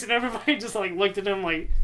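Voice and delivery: ordinary delivery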